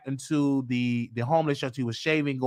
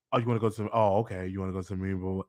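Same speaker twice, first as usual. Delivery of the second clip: low, quiet contempt; low-voiced mock disdain; nasty mother; 8 semitones lower, 6 dB quieter, much faster than usual. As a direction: imitate a dismissive, nasty mother under your breath. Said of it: "And very nasty".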